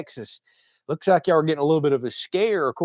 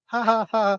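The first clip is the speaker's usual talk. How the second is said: higher-pitched fake "ha, ha, ha"; mock laugh; 9 semitones higher than usual. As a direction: laugh back as if playing along with the teasing. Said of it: "I was like".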